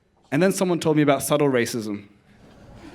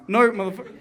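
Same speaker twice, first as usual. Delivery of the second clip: higher-pitched indignant comeback; mock indignant retort; 6 semitones higher, faster than usual. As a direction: act out a baffled refusal. Acted retort to junkie's question.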